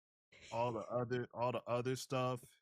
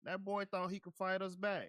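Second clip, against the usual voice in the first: put-on higher, boastful Southern accent; hammed-up Southern swagger; 6 semitones higher than usual. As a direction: brag like a Southern bully. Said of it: "Bubba's Southern maga country accent".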